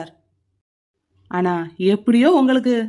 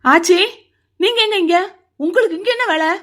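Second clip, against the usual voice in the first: startled surprise; 9 semitones higher than usual. Acted. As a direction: caught off guard seeing her. Surprised to see grandmother there.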